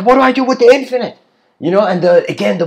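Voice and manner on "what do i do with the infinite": higher, louder, fast bewildered cry; anxious plea. Acted. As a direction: blurt the question in alarm. Person voiced in parable.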